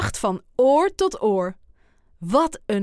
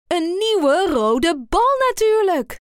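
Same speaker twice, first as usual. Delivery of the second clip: higher, louder triumphant idea; Kip's proud reveal, higher; excited; 7 semitones higher, 4 dB louder than usual. A narrator's reading. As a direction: announce it proudly. Kip announces her idea.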